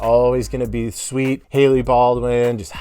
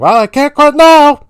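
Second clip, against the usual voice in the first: high, loud strangled whine; mock-panicked high yelp; George impression; 12 dB louder than usual. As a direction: squeal it like a cornered coward. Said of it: "He's like".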